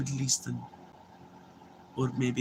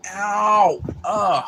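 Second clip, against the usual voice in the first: pained ow; 7 semitones higher, 7 dB louder than usual. Real, not acted. Sudden pain mid-sentence.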